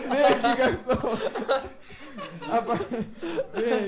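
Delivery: slow laughing call across the room. Real, not acted. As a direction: call the waiter over, laughing. Calling the waiter over, laughing.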